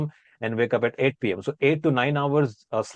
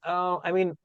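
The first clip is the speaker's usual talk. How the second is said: ordinary talk, nothing marked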